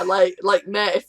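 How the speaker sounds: ordinary delivery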